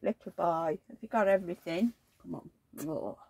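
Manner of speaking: normal talking voice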